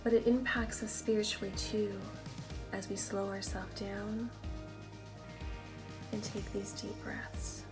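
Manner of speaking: calm them down, one breath at a time; guided meditation. Acted. Guided meditation over music.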